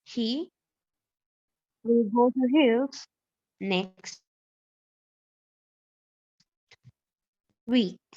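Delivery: very slow, long pauses between chunks; slow dictation. Shown as a demonstration. Teacher repeating the sentence while students write, slow halting dictation. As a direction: dictate word by word, waiting for writers. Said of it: "একটু শোনেন and please মেথডটা লিখেন".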